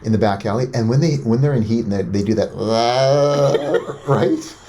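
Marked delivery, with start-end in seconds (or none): 2.5-4.7 yowling tomcat sound; cat fight impression. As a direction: imitate cats fighting in heat. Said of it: "That was actually really good".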